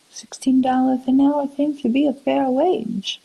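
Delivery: normal talking voice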